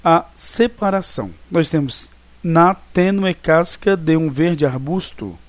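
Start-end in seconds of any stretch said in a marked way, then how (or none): 1.5-5.4 slow, deliberate reading of the verse; poetry teacher scanning a line aloud. Shown as a demonstration. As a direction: lay out each beat of the line slowly. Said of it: "nós vamos observar então aqui".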